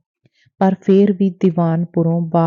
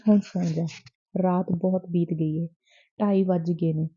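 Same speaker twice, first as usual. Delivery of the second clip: softer, hushed goodnight to his beloved; tender and soft; 5 dB quieter than usual. A narrator's reading. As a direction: say it gently, late at night. Lover gently sends her to sleep. (ਪਿਆਰ ਨਾਲ ਨਰਮ ਹੌਲੀ ਆਵਾਜ਼ ਵਿੱਚ)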